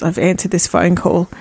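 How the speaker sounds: ordinary talk, nothing marked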